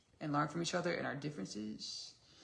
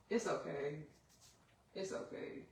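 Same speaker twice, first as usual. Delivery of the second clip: ordinary talk, nothing marked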